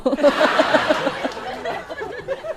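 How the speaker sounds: breathy rising laugh after punchline; own laugh. Real, not acted. Teller laughs after his airport joke. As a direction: let the laugh spill out.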